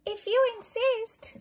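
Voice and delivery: very high, giggly hyena voice; eager hyena voice. Acted. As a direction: laugh it out like a hungry hyena. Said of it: "said the hyena, laughing eagerly".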